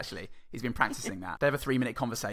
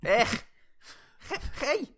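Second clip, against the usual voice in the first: put-on Scouse accent; 12 semitones higher than usual. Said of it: "Harry Enfield School of Scouse".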